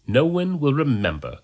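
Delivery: plain reading voice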